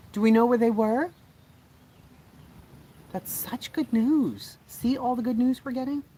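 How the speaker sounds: high, loud happy voice; excited relief. Real, not acted. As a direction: let the joy lift the whole line. News children found safe.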